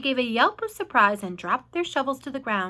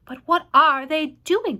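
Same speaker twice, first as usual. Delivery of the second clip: usual speaking voice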